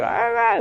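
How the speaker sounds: high-pitched mock baby cry; imitated baby fussing. Baby who can't talk yet, imitated fussing. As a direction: imitate a baby's wordless fussing. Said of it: "Baby's like".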